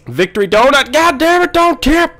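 high, loud frantic yell; rising panicked holler; alarmed shout. Real, not acted. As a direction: scream at the truck to stay upright. Truck is tipping in game.

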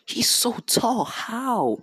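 high-pitched mock amazement; amazed. Onlookers staring at the sleeping giant, amazed. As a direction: gape at his height, voice jumping up. Villagers amazed at hero's height.

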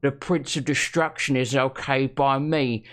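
broad Essex bloke reading aloud; lightly put-on Essex accent; Essex accent impression. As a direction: read it like a dim Essex lad. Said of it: "That was my impression".

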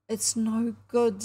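slow, mildly mournful; sad. A narrator's reading. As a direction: give up with understated sadness. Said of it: "said Ginger sadly".

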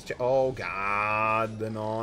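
loud, very drawn-out groan; italian angry cursing. Real, not acted. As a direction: drag out an exasperated oh god. Defence going badly in match.

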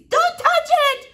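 very high, loud shriek; shrill mother crying out in horror; panicked shriek. Acted. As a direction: shriek a frantic warning. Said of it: "shrieked Flora's mother".

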